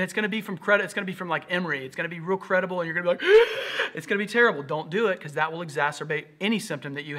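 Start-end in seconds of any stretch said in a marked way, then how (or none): 3.4-3.9 sharp mock-horrified gasp; shocked gasp. Person googling symptoms finds bad news, acted shocked gasp. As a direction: gasp as if reading terrible news.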